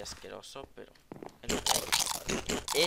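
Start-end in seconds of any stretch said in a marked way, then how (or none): 1.4-2.8 drawn-out taunt. Acted.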